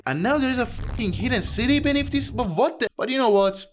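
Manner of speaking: incredulous rant. Real, not acted. Gamer finding a city under the dungeon, shocked and ranting. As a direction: blurt it out, stunned by the hidden city.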